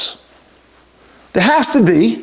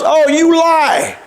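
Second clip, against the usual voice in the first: retold heckle yell; 9 semitones higher, much slower than usual. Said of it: "Someone yells".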